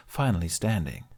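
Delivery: ordinary delivery, nothing marked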